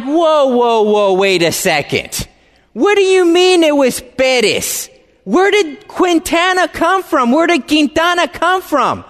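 high, urgent "whoa, whoa", then pressing questions; stunned disbelief. Retold or imitated. Son reeling at his father's real name, high stunned questions. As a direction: reel from the news and demand answers. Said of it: "I'm like".

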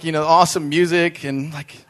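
normal talking voice

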